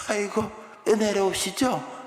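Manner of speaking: slow, sneering mock praise; mocking gratitude toward God; sarcastic praise. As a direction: drawl the praise with heavy sarcasm. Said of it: "흉내가 됐나 모르겠다".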